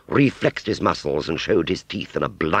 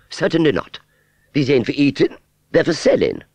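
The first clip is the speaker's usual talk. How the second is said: high, loud, affronted refusal; haughty fairground aunt; 11 semitones higher, 5 dB louder than usual. Acted. Aunt Sally refusing Wurzel an apple, haughty. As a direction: refuse him in a piercingly high voice.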